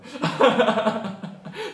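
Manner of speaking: a loud, high burst of real laughter. Real, not acted. Lecturer laughing at her own fast pace, loud laugh. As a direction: laugh at yourself for rushing.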